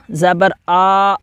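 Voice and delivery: زبر آ drawn out slowly with a long آ; wrong stretched vowel. Shown as a demonstration. Teacher showing the wrong drawn-out reading. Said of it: "آ پڑھیں گے تو یہ غلط ہو جائے گا".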